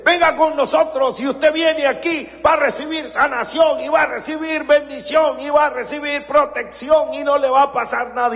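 high, fast hyped-up pitch; hammed-up high fast preacher pitch; mock sales pitch. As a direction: play a huckster promising everything. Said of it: "se van a donde dice".

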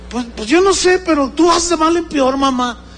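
high, impatient reproach; lightly acted high-pitched nagging; mock scolding. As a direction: imitate a child losing patience with mother. Child answers mother unsympathetically.

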